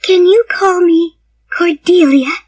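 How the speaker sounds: put-on high child's voice, pleading; hopeful little girl. A narrator's reading. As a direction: ask it as a hopeful small girl. Child asks for another name.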